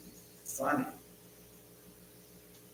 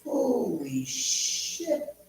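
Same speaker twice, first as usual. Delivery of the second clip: drawn-out, much higher mock awe; awed disbelief; 9 semitones higher, 4 dB quieter, much slower than usual. Retelling seeing the huge bong, drawn-out mock awe. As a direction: stretch it out, stunned by the giant bong. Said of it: "And I'm going".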